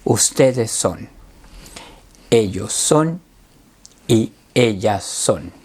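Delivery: deliberate, spaced teacher recitation; slow classroom drill; slow conjugation drill. Shown as a demonstration. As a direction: set out each form slowly for learners to repeat.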